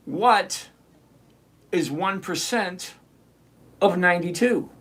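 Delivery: slow, with long pauses between phrases. Shown as a demonstration. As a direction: space it out: what, is one percent, of ninety-two. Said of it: "Let me say it a little slower".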